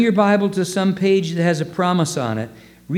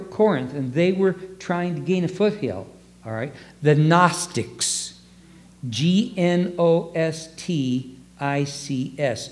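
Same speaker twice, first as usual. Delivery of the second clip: normal talking voice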